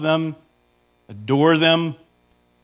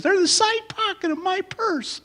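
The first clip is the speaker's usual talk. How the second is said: mock wife snapping; 4 dB quieter than usual. Wife says, hits chest.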